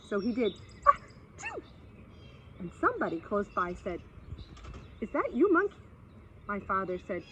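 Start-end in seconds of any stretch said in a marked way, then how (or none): 0.9-1.6 high, loud mock sneeze. Father in the jungle sneezing loudly. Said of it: "he just had to sneeze". 5.0-5.7 tortoise voice. Acted. Hidden tortoise calls in dark.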